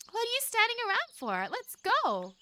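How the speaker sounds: lightly voiced bossy girl snapping; a hint of an impatient camp leader. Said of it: "Salvia snapped at me".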